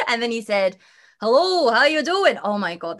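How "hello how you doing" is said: put-on a quick, louder, higher Scottish accent for his greeting. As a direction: mimic a Scottish singer saying hello, raised and bright. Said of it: "I'm not gonna do a Scottish accent".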